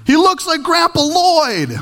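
high, slow excited holler; reenacted delivery-room yell. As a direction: blurt it out as a new father. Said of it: "The first thing is I yelled out".

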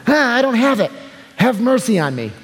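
high, strained begging; frightened plea. Acted. Choked servant pleading with his attacker, high and scared. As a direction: beg for your life while being shaken.

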